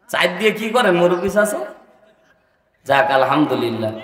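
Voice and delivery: ordinary delivery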